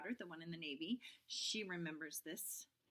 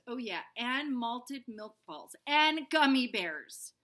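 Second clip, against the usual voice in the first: excited; 5 semitones higher, 13 dB louder, slower than usual. Acted. Junie listing treats excitedly.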